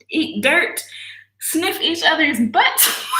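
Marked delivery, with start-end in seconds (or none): 1.4-3.2 slow words into a high shrieking laugh. Real, not acted. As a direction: laugh out the disgust. Laughing in disgust.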